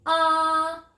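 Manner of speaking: 'Ah' held long on a high level note; short O sound. Shown as a demonstration. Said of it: "One more time".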